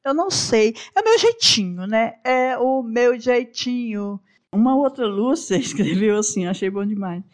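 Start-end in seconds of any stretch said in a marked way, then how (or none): 0.9-1.9 higher, louder shrugging excuse; much higher, a little louder take on her own manner; playful excuse. Real, not acted. Repeats phrase two ways. 2.2-4.2 slow drawn-out shrug; slowly repeated, resigned self-description. Real, not acted. Repeats phrase two ways.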